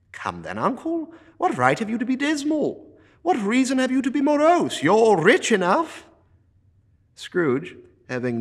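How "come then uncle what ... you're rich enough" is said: high, loud, playful mimicry; teasing nephew. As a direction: throw his own words back cheerfully. Nephew teases back.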